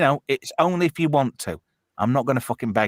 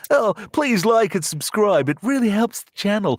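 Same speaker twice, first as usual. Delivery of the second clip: insincere, patronizing plea; 8 semitones higher than usual. Retold or imitated. Streamer parodying needy creators asking for subscribers, insincere patronizing plea. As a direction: plead for subscribers with syrupy fake sincerity, pitched up. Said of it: "comes across a bit insincere and patronizing".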